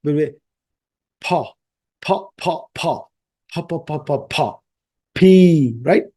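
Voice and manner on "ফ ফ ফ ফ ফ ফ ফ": quiet repeated ফ with both lips together; lip-sound demo fading softly; soft plosive drill. Shown as a demonstration. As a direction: softly pop ফ again and again. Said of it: "দুইটা ঠোঁট একসাথে লাগবে".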